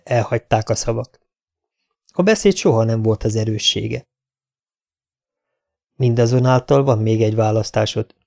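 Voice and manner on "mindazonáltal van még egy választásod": ordinary delivery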